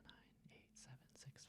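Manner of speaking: whispered countdown, barely audible. Shown as a demonstration. Teacher showing a lie detector trick, whispered count. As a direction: count backwards under your breath. Demonstrating counting under breath.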